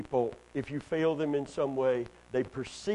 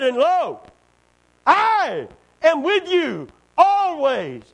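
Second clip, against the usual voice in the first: very loud, high and drawn out; slow proclaimed promise; 11 semitones higher, 10 dB louder, much slower than usual. Acted. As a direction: stretch every word like a solemn vow. Slow climactic quote of Jesus.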